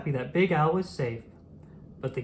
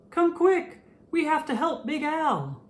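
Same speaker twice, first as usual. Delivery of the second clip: very high, urgent calling out; urgent call for help; 12 semitones higher than usual. A narrator's reading. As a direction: shout to the other fish to hurry. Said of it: "Shrimpy was scared".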